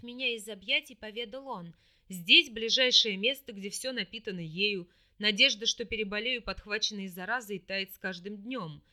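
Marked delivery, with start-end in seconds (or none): 2.1-8.8 lower, firm demon voice. A narrator's reading. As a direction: speak as the brooding demon. Demon lover voiced in story.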